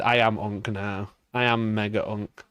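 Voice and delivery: usual speaking voice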